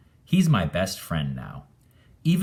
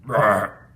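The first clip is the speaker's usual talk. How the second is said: ghost burp; 3 semitones higher, 7 dB louder than usual. Acted. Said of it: "it burped again".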